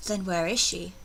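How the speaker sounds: ordinary talk, nothing marked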